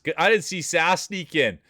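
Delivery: surprised. Real, not acted. Surprised a racer joined.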